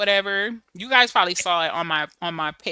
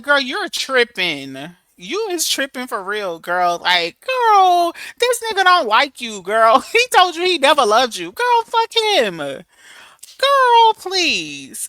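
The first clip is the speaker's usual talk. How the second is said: exasperated scolding; 3 semitones higher, 5 dB louder, much slower than usual. Retold or imitated. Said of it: "'cause I was like".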